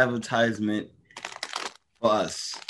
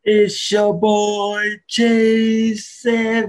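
high, loud, drawn-out hype intro; hype man; 10 semitones higher, 7 dB louder, much slower than usual. Acted. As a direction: shout your name to the crowd.

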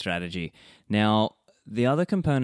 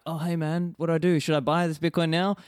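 higher, eager clueless friend; imitating a noob friend; 7 semitones higher than usual. Host mimics a noob friend asking what to buy, higher. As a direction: lightly play the clueless newcomer asking. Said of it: "being like".

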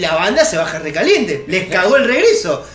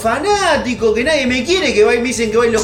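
the happy double complaining; 2 semitones higher than usual. Retold or imitated. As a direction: whine about the fans with a laugh. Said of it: "Y el otro está".

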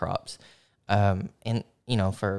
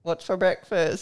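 high mock asking voice; 10 semitones higher than usual. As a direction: play a weary family member asking. Acting Irish family asking about meals.

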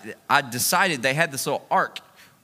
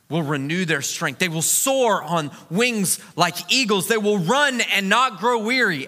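raised-pitch, emphatic sermon delivery; preaching; 8 semitones higher, slower than usual. Real, not acted. Preacher turning his race story into scripture, emphatic preaching. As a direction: preach it out, pitched up and driving, stretching key words.

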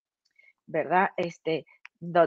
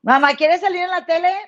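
calling out; 8 semitones higher, 6 dB louder than usual. Real, not acted. Calling out to mom in bathroom.

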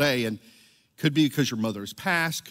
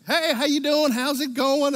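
wife's cheery call; 11 semitones higher than usual. Retold or imitated. Wife calling on the phone.

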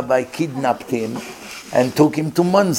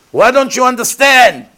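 high, loud, exasperated yell; furious shout; 9 semitones higher, 8 dB louder than usual. Retold or imitated. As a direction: shout at the doctor who won't listen. Angry Israeli relative demanding.

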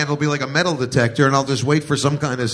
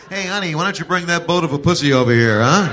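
mock crude husband; 5 semitones higher, 6 dB louder, faster than usual.

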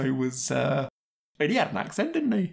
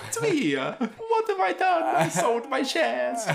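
mock Jared Leto Italian accent; slower than usual. Film critic parodies Jared Leto in House of Gucci, hammed-up Italian accent. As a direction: ham up a dubbed-thriller Italian accent. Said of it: "accent that was made up by non-Italians for dubbed movies".

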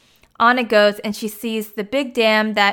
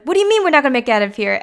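fast, higher-pitched imitated outburst; acted-out rattled challenge; alarmed disbelief; 4 semitones higher, much faster than usual. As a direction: fire the question back in mock alarm. Retold Anna alarmed at Kristoff.

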